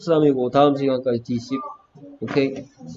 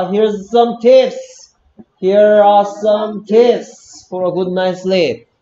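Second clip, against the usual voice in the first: loud model reading; 5 semitones higher, 7 dB louder, slower than usual. Shown as a demonstration. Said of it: "거꾸로 읽고 있습니다".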